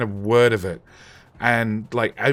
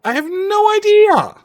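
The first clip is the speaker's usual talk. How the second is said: high, slow, incredulous squeak; exasperated; 7 dB louder than usual. Acted. Streamer answering a chat question about Dracula, high slow squeak. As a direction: squeak it out in mock disbelief.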